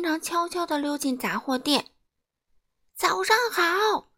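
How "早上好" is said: high, drawn-out hollered greeting; roaring grocer. A narrator's reading. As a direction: holler good morning at a customer. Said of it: "杂货店老板吼道". (杂货店老板高声吼叫)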